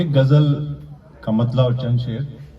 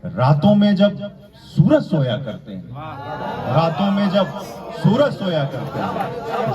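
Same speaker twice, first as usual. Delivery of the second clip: measured declamation with pauses between phrases; mushaira-style verse recitation; poetry recital; 3 semitones higher than usual. Acted. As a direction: recite the couplet to an audience, landing each phrase. Recites ghazal couplets.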